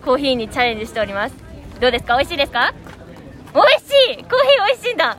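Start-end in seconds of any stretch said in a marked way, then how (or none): 3.5-5.2 excited. Real, not acted. Cheerful praise of a child.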